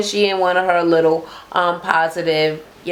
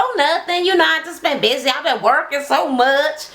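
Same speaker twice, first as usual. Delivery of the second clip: Juicy's reply; 7 semitones higher than usual. Retold or imitated. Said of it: "She was like".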